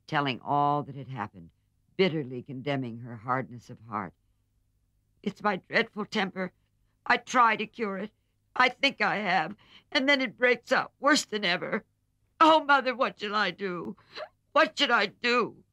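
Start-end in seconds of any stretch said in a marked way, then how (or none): 5.2-15.6 much higher and louder, anguished pleading; a desperate girl begging her mother for help; crying in despair. A narrator's reading. Jo crying to her mother over her temper, anguished despair. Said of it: "cried Jo in despair".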